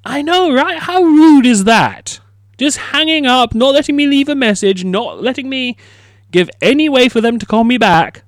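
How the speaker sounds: high-pitched mock outrage; incredulous, offended rant; indignant. Real, not acted. Outrage at Iceland hanging up.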